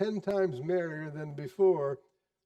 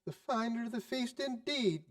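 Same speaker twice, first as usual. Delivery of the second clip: indignant wife; 7 semitones higher, 4 dB quieter than usual. Acted. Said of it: "cried Missus Hatchett, reddening".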